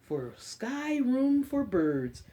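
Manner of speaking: slow storybook read-aloud; reading to children. Acted. Librarian reading an Earth book to kids, storybook voice. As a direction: read it gently to a class of little ones.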